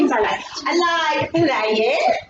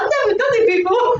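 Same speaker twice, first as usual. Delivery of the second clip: clowny voice; 7 semitones higher, 4 dB louder, faster than usual. Acted. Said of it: "I have got a clown voice".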